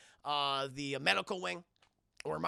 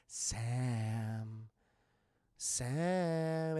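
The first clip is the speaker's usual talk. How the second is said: ghostly call; 7 semitones lower than usual. Retold or imitated. Ghost wife calls him.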